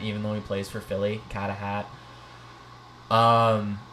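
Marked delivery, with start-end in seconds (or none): 1.3-1.9 Carter Hart as "Cahtah Haht" in Boston accent. Shown as a demonstration. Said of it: "you have to say his name in a Boston accent".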